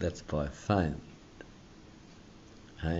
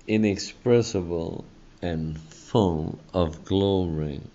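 slow, swelling preacher's reading of the verse; slow, heartfelt reading from the pulpit; fervent scripture reading; slower than usual. Acted. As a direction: lift the verse up slowly like a hymn. Preacher reads scripture with fervour.

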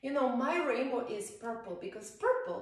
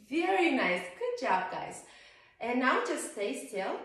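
usual speaking voice

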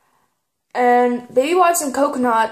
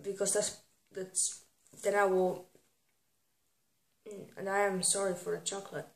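mumbled aside; 4 semitones lower, 15 dB quieter than usual. Real, not acted. Mumbling with mouth full.

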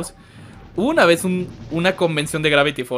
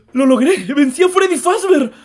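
high, loud victory cry; triumphant; 9 semitones higher, 4 dB louder than usual. Real, not acted. Streamer celebrates beating Freddy Fazbear, overjoyed yell. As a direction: burst out celebrating.

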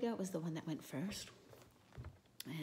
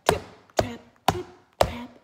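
much louder stomping trip-trap; heavy trip-trap; 5 semitones higher, 15 dB louder than usual. Acted. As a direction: stamp the hooves loud like a big goat. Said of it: "made a little more noise".

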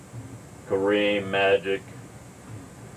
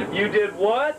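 much higher, amazed question; high surprised voice; 11 semitones higher than usual. Pretend. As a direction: play the astonished partner. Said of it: "start a tape something like this".

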